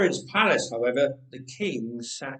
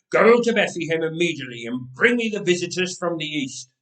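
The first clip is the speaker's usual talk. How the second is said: louder, fast order to guards; urgent command; 2 semitones higher, 7 dB louder, faster than usual. Acted. Herod orders his guards at once, loud fast command. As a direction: bark the order quickly.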